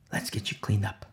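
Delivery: low, softened voice for Peter; hushed, gentle offer; soft quoted reassurance. Acted. Soft first take of Peter's words.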